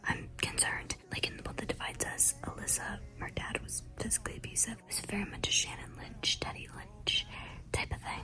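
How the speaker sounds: hushed late-night whisper. Real, not acted. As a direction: whisper so no one wakes. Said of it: "so I have to whisper".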